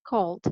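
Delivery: "called" as one syllable ending in d; voiced -ed ending. Shown as a demonstration. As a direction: close "called" on a voiced d, no extra vowel. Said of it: "it's pronounced just like this".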